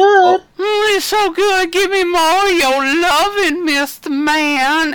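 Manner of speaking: very high swooning falsetto, loud; silly high voice. Retold or imitated. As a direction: crank it up into a goofy falsetto. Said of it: "why you're going with that voice".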